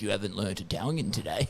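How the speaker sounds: low, softly spoken creepy reminder; creepy low-voiced owl; creepy app mascot. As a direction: pop up and creepily chide, low and quiet. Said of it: "creepy. He pops up, he's like".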